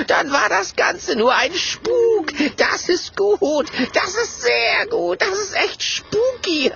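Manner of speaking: very high, gleeful, laughing voice; delighted ghost in hysterics; uproarious amusement. A narrator's reading. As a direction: crow it between peals of laughter. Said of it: "bricht in schallendes Gelächter aus".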